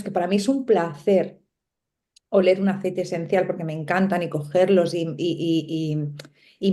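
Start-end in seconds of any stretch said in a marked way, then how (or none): none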